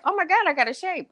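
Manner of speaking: high, quick delighted gush. Retold or imitated. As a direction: rush it out in delight. Reaction to the result.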